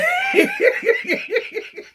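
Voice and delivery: high squealing laugh. Real, not acted. Shrieking with laughter at the story.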